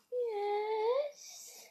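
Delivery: high, soft squeaky dog voice; dog's voice. Pretend. Girl answering for her dog in a high little voice. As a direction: speak for the dog, high and small.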